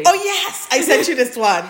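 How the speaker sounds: loud, high delighted recognition; excited. Real, not acted. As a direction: burst out happily remembering. Excited recognition of shared fic.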